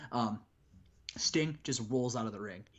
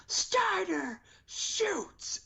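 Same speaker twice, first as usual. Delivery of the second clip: very high, slow drawn-out announcer yell; announcer call; much slower than usual. Retold or imitated. Commentator announcing the entrance, a slow high call. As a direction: stretch it out like a ring call. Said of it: "we hear".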